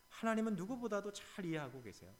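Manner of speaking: usual speaking voice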